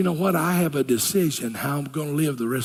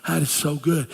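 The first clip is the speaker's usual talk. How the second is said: ordinary talk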